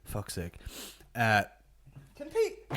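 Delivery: ordinary delivery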